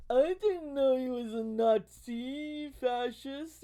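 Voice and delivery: loud, high, slow mock-clueless voter; mocking imitation. Quoting people who voted for Trump, loud mocking imitation. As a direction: drag it out loudly in a high mocking voice.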